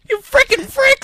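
shout. Acted. Mock outburst while laughing.

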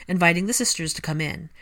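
usual speaking voice

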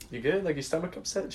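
hushed, careful check-in; tentative concern. Retold or imitated. Man asking girlfriend about her stomach, hushed and tentative. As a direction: ask very softly, treading carefully.

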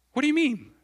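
high, loud acted disbelief; incredulous outburst. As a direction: throw the question out, baffled. Acted disbelief at Jesus' words.

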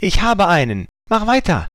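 a gleeful little cheer; grinning hippo. A narrator's reading. Hippo catching a fish, cheering its friend on. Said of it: "machte eine schnelle Bewegung und grinste".

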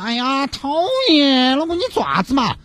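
put-on high, whiny girlfriend voice; coy girlfriend. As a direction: play the spoiled girlfriend acting coy. (撒娇的女朋友，高音嗲声)

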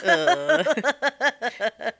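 high loud fake groan; a hammed-up shared sigh; demo sigh. Shown as a demonstration.

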